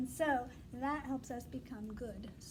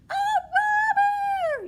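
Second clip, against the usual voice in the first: much higher, loud cry of alarm; shouted alarm; 15 dB louder, much slower than usual. Shown as a demonstration. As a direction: shout it out to warn the hive. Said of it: "Use your good voice".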